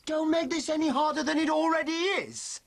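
mocking echo. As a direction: throw her words back at her. Husband mockingly echoes wife's words.

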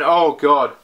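ordinary delivery

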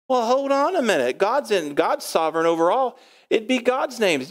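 objecting. Acted. Objector challenging the preacher on God's sovereignty, mildly protesting. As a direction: cut in with an acted objection, pitch swooping up.

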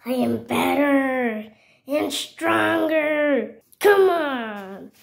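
slow, hammed-up fighter's taunt; boastful challenge. Fighter dares the opponent to attack, slow boastful challenge. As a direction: stretch it out, building to a loud challenge.

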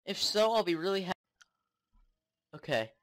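normal talking voice

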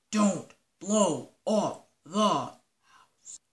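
very slow, drawn-out warning; stern warning. Acted. Link warns the team, very slowly. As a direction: spell it out so they listen.

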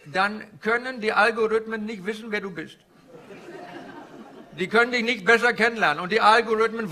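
put-on comic Indian-accented stand-up guru.

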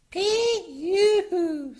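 high, loud mock disgust; holding her nose. A narrator's reading. Polly reacts to a stink, loud high squeal. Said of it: "holding her nose".

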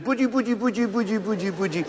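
put-on soft cooing "Buddi" to a toddler; dad soothing crying daughter, soft baby talk. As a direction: lull a small child over the phone, fading. Father soothing crying toddler by phone.

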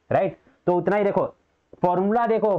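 usual speaking voice

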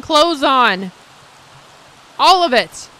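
loud, high complaint; exasperated yell. Real, not acted. Player yelling at Sim in the hot tub with clothes on. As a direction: shout in disbelief.

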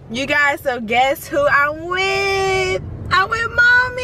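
high, loud, thrilled announcing; excited shout. Real, not acted. Vlogger excitedly shows viewers she is with mom. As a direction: burst out with joy to show off mom.

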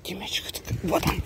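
whispered teasing question; whispering. Real, not acted. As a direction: whisper it to tease the dog. Teasing dog, whispered repeat.

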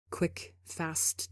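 usual speaking voice

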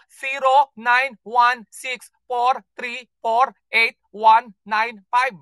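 digit by digit, slowly and deliberately; slow dictation. Shown as a demonstration. Host dictating the phone number to callers, slow and deliberate. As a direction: spell out the number with a gap after each digit.

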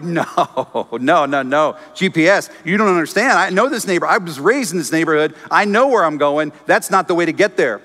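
arguing with GPS. Pretend. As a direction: insist you know the way better.

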